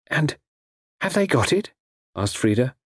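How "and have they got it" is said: high, questioning woman's voice; anxious young woman. A narrator's reading. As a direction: ask as an anxious captive. Frida asks anxiously about the paper.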